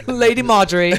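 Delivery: bow to the lady with a flourish; mock courtly. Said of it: "That's Shakespearean".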